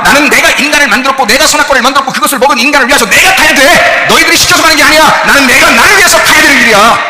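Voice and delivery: very loud, high shouted sermon; full-force preaching. Acted.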